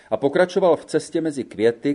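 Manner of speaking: plain reading voice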